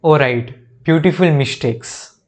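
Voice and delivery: ordinary talk, nothing marked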